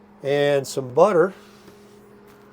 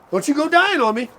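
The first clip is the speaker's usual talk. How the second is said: high mock plea; 12 semitones higher, 3 dB louder than usual. Talking to the grill.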